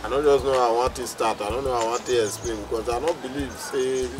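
very soft, low, troubled murmur; subdued, hesitant confession; downcast reluctance. Acted. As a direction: explain it low and heavily. Troubled man reluctant to explain.

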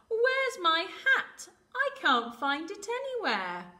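high, loud, slow wail of a chick; fretting little chick. Acted. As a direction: play a chick who has lost something. Chick voiced, searching for hat.

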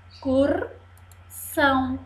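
"Excursão" split into es, cur, são; syllable by syllable. Shown as a demonstration. Teacher shows three syllables, "Excursão" broken apart. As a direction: pause between each syllable of "Excursão". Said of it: "Três sílabas".

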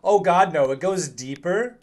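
real dread breaking into a yell; frightened cry. Real, not acted. Finds the basement goes deeper.

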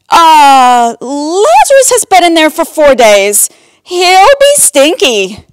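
high, loud comic whine; squeamish protest. A narrator's reading. Sister warning about the tomb, squeamish and loud. Martha objects to opening tomb.

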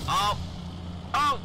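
startled shout. Real, not acted. Sudden danger in game.

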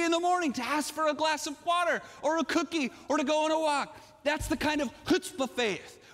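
high, rolling preacher's build; impassioned high-pitched exhortation; fervent preaching. Real, not acted. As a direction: pile up the list with rising zeal. Preaches with rising fervor.

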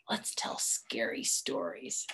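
low, eerie whisper; spooky whisper. Acted. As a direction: hush it like the start of a ghost story. Boy suggests scary stories.

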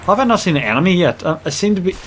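ordinary talk

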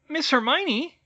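very high, loud little elf voice; bleary house-elf. A narrator's reading. Sleepy elf greets Hermione, bleary high-pitched squeak. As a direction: squeak it as a just-woken elf. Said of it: "she said blearily".

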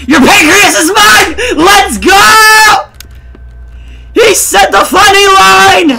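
loud, high celebratory yell; hyped shout. Real, not acted. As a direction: shout it like a huge win. Hypes up a game catchphrase.

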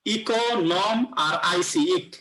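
"ইকো, নম, ইক" in separate drawn-out pieces; syllable by syllable. Shown as a demonstration. Teacher breaking the word up for the class, syllable by syllable. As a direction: split the word slowly into its syllables. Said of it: "তিনটা syllable যদি ভাগ করি".

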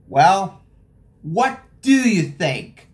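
ordinary delivery, nothing marked

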